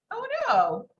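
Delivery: high-pitched jump of dismay. Real, not acted. Teacher spots her own mistake in the code, dismayed. Discovers the code went wrong.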